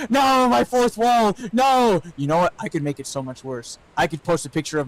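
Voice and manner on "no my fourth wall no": high, slow wail of mock despair; hammed-up cry over the broken fourth wall; mock anguished cry.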